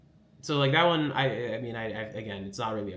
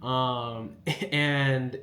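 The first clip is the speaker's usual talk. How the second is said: usual speaking voice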